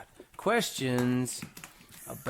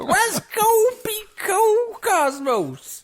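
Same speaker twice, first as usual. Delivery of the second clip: silly voice; 7 dB louder, slower than usual. Pretend. Silly high voice.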